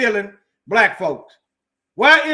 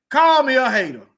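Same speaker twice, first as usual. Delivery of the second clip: louder, defiant dare; 5 semitones higher, 5 dB louder than usual. Real, not acted. Speaker daring his enemies, defiant and louder. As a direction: dare them, stressing call.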